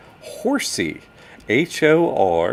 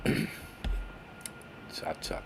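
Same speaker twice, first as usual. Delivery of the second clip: ordinary talk, nothing marked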